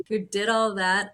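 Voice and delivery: ordinary talk, nothing marked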